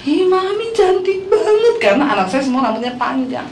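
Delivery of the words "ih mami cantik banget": put-on high, drawn-out gushing little-girl squeal; delighted daughters. Daughters greeting their mother's new long hair, mock delighted gushing. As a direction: imitate thrilled kids admiring mum. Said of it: "seneng banget".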